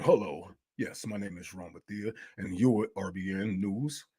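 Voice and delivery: low, soft, stiff fake-polite news anchor voice; mock newscaster. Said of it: "everybody talk like this".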